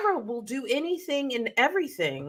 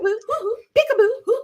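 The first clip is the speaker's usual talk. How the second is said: high teasing peekaboo hoots; teasing rat; 12 semitones higher, faster than usual. Retold or imitated. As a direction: taunt like a cheeky rat playing hide and seek. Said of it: "this rat is going peekaboo".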